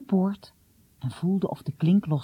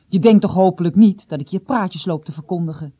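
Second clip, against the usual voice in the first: louder, indignant father; irritated; 8 dB louder than usual. A narrator's reading. Father doubted by his son, irritated. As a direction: rebuke with a touch of annoyance. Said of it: "Vader was opeens geïrriteerd".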